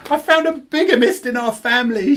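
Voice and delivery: high, loud gleeful announcement; excited mum. Retold or imitated. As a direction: relish the scandal out loud. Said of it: "she says".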